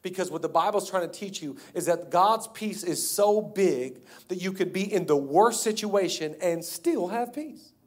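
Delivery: ordinary talk, nothing marked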